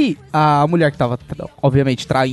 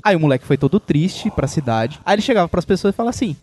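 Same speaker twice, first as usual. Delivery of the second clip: ordinary talk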